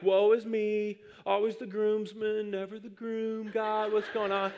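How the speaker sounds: mock tragic sigh to heaven; melodramatic lament. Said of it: "and I'm like".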